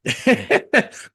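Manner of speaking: high short laugh. Real, not acted. Host laughing off the in-joke, high chuckle.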